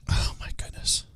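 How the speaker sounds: whispered. Real, not acted. Shocked aside after reading comment.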